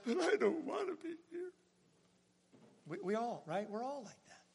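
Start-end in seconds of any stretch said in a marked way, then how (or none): 0.0-1.5 high whiny complaint; whiny heart. Retold or imitated. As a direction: sulk like a child. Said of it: "my heart still says".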